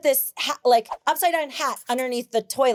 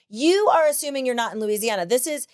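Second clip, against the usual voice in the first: ordinary delivery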